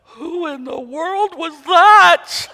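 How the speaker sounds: high, slow, amazed laughing question; stunned boy. Acted. Small boy startled by his unmade-up grandma, high and amazed. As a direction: gasp it out in wide-eyed disbelief.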